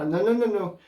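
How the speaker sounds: rapid-fire high-pitched denial; comic high-voiced backpedalling; protesting innocence. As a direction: squeak out a hasty mock protest. Acting out denial to wife.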